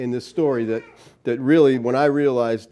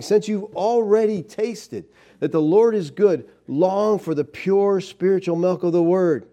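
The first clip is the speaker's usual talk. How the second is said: scripture reading; 5 semitones higher, slower than usual. Acted. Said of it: "That's what Peter's saying".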